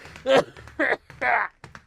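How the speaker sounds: long choking cough; coughing fit. Real, not acted. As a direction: cough out inhaled coffee. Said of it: "I completely fucking breathed it in".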